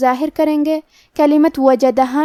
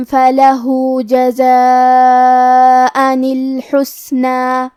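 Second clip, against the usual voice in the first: slow, louder chanted tajweed recitation; Quran recitation; 3 dB louder, slower than usual. Acted. Teacher reciting the verses for students, slow melodic tajweed. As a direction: recite each verse slowly and fully.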